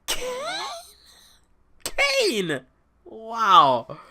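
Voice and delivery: high, cracking cry of shock; stunned disbelief. Retold or imitated. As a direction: relive hearing the surprise return. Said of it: "I'm like".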